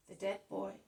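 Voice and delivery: usual speaking voice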